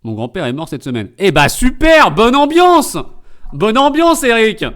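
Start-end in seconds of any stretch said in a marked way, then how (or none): 1.2-4.6 loud, high mock enthusiasm; sarcastic cheer. Streamer reacting to a grandfather's death, sarcastic cheer. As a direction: cheer far too brightly at awful news.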